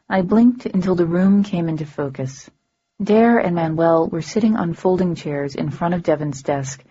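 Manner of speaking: ordinary delivery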